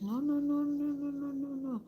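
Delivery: high, pleading string of no's; distressed denial. Retold or imitated. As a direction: imitate her grief-stricken no no no. Grieving coworker denies, voiced.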